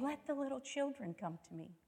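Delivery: mock the sugary gentle Jesus, softly; mock breathy nicey-nice. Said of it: "breathy voice saying".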